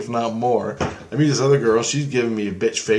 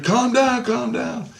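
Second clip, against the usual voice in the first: much higher, drawn-up voice; imitated soothing plea; 12 semitones higher, slower than usual. Christ tries to settle the yelling dreamer, high imitated plea.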